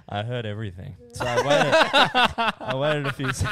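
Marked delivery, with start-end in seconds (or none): 1.1-3.5 high, loud words through laughter; laughing. Real, not acted. Storyteller losing it at his own story, laughing. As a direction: crack up while trying to carry on.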